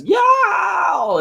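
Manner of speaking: very high drawn-out cartoon yowl; mock yowl. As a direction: imitate a cartoon villain's high cry. Hillbilly villain's cry imitated.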